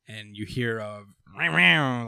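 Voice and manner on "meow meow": imitated revving chainsaw; chainsaw sound. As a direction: buzz it out like a chainsaw. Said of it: "like a chainsaw".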